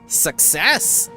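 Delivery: ordinary talk, nothing marked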